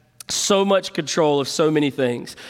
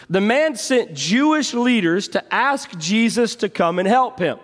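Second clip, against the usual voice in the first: usual speaking voice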